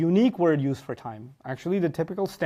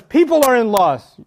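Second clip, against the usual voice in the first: loud, slow, raised pronouncement; forceful proclamation; 7 semitones higher, 10 dB louder than usual. Acted. Preacher quoting scripture to listeners, loud slow proclamation. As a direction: proclaim it slowly to everyone, full voice. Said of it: "When Allah says".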